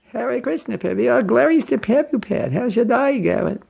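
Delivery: high-pitched, put-on Australian greeting; Australian accent. Shown as a demonstration. Australian devotee greeting a fellow devotee, Australian accent. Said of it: "That Australian accent".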